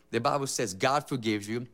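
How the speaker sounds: ordinary talk, nothing marked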